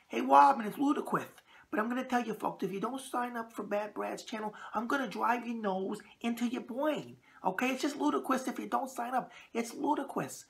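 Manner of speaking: high lisping Tyson voice threatening fans; squeaky lisp, mock menace; Mike Tyson impression. Host as Tyson urging viewers to subscribe, high squeaky lisp. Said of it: "Let me see if I can get his voice".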